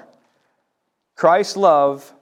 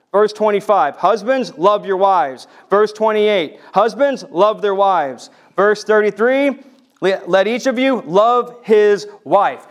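raised preacher's voice, higher pitched; emphatic scripture citing; 6 semitones higher, 8 dB louder than usual. Real, not acted. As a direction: hammer each verse home. Cites verses with force.

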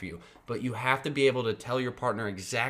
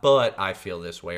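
"But" louder at the start; stress on but; 3 semitones lower, 6 dB louder than usual. Shown as a demonstration. Partner hears the wrong word, stress on "But".

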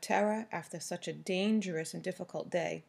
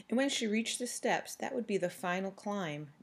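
plain reading voice

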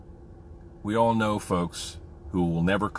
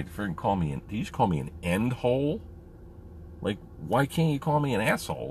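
mock indignation; 4 semitones higher than usual. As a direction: pitch swooping up in disbelief. Acts out his own indignant reply.

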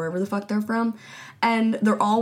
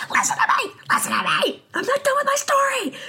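high, strained mock yell at her family; mock shrill yelling. Mocking Kelly yelling at husband and daughter, high and strained. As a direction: parody her demanding to be heard. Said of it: "She was like".

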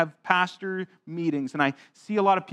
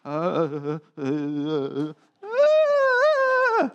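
put-on nervous stammering child; 3 semitones lower, much slower than usual. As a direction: act out a scared kid frozen at the front of the class. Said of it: "Here's Pastor Thomas speaking in front of the class".

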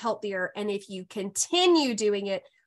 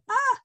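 short, high delighted squeal; a high "ah!" tipping into laughter; excited squeal; 3 dB louder than usual. Real, not acted. Podcast host cheers clients getting pregnant, excited squeal.

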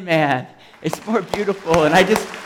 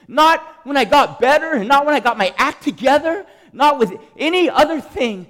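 real impassioned yelling; 6 semitones higher, 7 dB louder than usual. Real, not acted. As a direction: cry it out to the whole room. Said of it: "I'm yelling".

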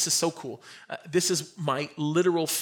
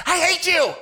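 put-on higher, louder kid yelling at dad; childish angry shout; 12 semitones higher, 8 dB louder than usual. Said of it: "We'd say".

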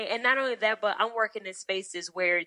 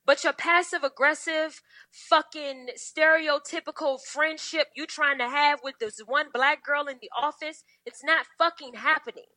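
calling her out; 6 semitones higher than usual. Retold or imitated. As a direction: lecture her sharply, higher. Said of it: "I said".

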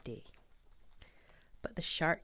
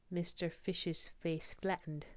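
ordinary delivery, nothing marked

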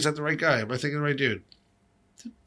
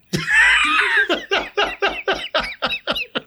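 big laugh. Real, not acted. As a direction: howl with real laughter. Cracking up at the line.

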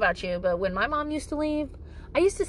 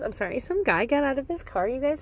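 usual speaking voice

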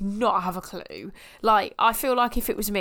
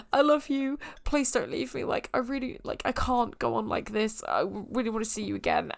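higher, half-tearful pleading; lightly acted plea; 5 semitones higher than usual. Rose begging the Doctor not to leave, mock-tearful higher plea. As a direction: beg him not to leave, mildly overacted.

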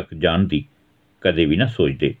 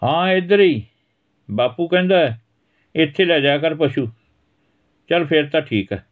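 put-on higher boyish voice, cheerful; boy replying; 8 semitones higher than usual. Dari telling Dhira he'll come, cheerful. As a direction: answer your friend brightly.

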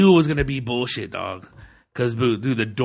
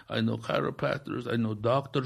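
hushed shady offer; 6 dB quieter than usual. Retold or imitated. Body shop guy pitching insurance fraud, in a hushed murmur. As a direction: offer the scam under your breath.

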